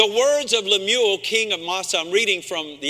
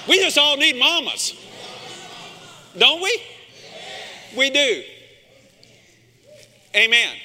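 preaching call and response; 4 semitones higher than usual. Real, not acted. Pastor rallying the congregation to say it with him. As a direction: rouse the church to answer back.

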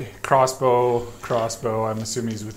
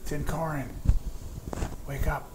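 quiet wake-up call; 4 semitones higher, 5 dB quieter than usual. Acted. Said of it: "I'm going to be like".

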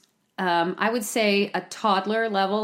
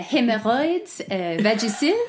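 French accent. Shown as a demonstration. Showing the co-host it sounds better, French accent. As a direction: make silly words sound elegantly French. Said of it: "anything with a French accent".

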